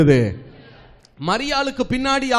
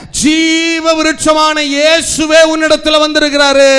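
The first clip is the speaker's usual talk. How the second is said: much higher, louder fervent cry; proclaiming shout; 7 semitones higher, 7 dB louder than usual. Acted. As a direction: shout the good news at full force. Cherubim proclaiming to the church. (உரத்த உணர்ச்சிமிக்க அறிவிப்பு)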